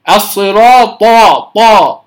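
"الصراط، طا" with a voiced, heavy d-like ط. Shown as a demonstration. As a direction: turn the ط of "الصراط" towards ض. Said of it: "خطأ أيضًا". (نطق "الصراط، طا" خطأً بطاء كالضاد)

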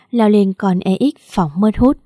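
ordinary delivery, nothing marked

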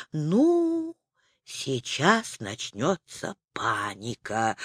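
тихо и медленно, себе под нос; пробормотать под нос; бормоча. A narrator's reading. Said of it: "пробормотал Незнайка". (тихое бормотание)

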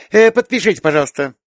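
high, loud, urgent request; calling secretary. A narrator's reading. Secretary catches up with director for signature, urgent. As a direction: call after the boss, pitched high.

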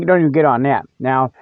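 ordinary talk

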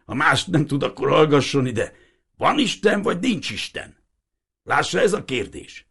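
a lightly voiced old man, insistent; old fisherman. Old Hiribi demanding the priest explain the boat, lightly voiced.

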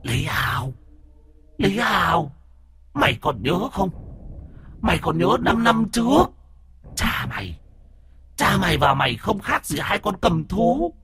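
high, ghostly voice of a vengeful demon; eerie demon voice. Acted. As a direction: creep him out with a shrill, uncanny spirit voice. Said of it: "giọng quỷ dị đáng sợ".

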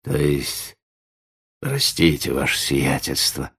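put-on faltering old man, with pauses; hesitant. A narrator's reading. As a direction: falter apologetically before the gentry. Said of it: "замялся Ладышкин".

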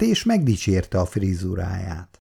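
usual speaking voice

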